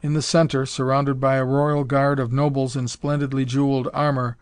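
ordinary delivery, nothing marked

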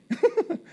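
squeaky delighted laugh up high; high burst of laughter. Real, not acted. Thinking of living forever, a high giddy laugh.

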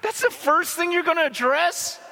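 disbelieving, pitch jumps high; can't believe that's the first thing; incredulous. Real, not acted. Pastor reacting to Paul's letter, incredulous. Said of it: "I'm going, "What?".

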